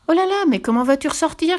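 high-pitched, fretful exclamation; alarmed cry. A narrator's reading. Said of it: "cria Annika". (cri affolé, voix aiguë)

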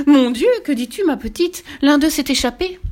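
high, loud, panicky exclamation; alarmed cry. A narrator's reading. Startled lady answers the girl at the window, high alarmed cry. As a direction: cry out in alarm, pitched well up. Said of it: "poussèrent de grands cris".

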